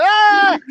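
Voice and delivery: high wordless yell; high-pitched pointing holler; re-enacted scream. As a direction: scream "ah" at the player on screen. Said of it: "screaming at him".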